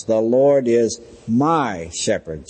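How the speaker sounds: slow, with MY set apart and drawn out; stress on MY. Retold or imitated. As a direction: lean deliberately on the word "my". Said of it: "She emphasized that word "my".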